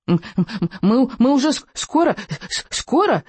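high, stammering squeal; excited stutter, pitch way up; squealing little dragon. Acted. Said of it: "верещал Беззубик".

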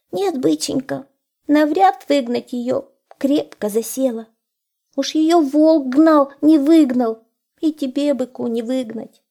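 high, plaintive hare voice; tearful hare. A narrator's reading. Hare telling the wolf his sad story, high and plaintive. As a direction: lament in a high little voice.

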